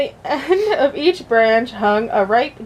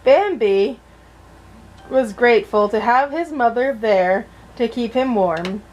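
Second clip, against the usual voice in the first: usual speaking voice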